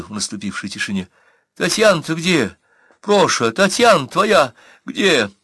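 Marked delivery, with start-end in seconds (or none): 1.6-5.3 high, urgent questioning; distressed woman's raised voice, slowing at the end; anxious sister. Acted. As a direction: plead for an answer, pitched well up. Sister anxiously questions her bleeding brother.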